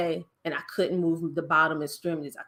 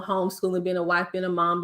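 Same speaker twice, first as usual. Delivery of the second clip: normal talking voice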